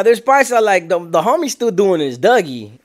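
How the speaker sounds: ordinary talk, nothing marked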